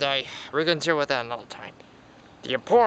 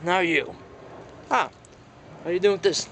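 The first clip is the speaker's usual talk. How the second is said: usual speaking voice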